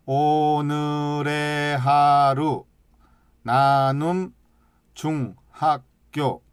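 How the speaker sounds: each syllable said slowly and evenly, no stress; slow, even syllables, one at a time. Shown as a demonstration. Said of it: "홍자, 길자, 동자 딱, 딱 끊어 있죠". (한 글자씩 또박또박 끊어 읽기)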